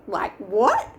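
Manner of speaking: mock-shocked question, rising; disbelieving. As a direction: pitch jumps high in retold disbelief. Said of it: "I was like".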